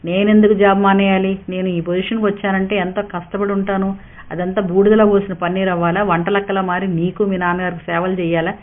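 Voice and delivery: ordinary delivery, nothing marked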